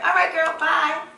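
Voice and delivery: excited goodbye. Retold or imitated. Sign-off in retold call.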